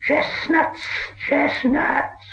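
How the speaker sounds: high, breathy hiss, voiceless and creeping; hissing Gollum whisper. A narrator's reading. Gollum whispering to himself as a stranger appears, hissing. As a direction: hiss it like a starving cave creature. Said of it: "he hissed".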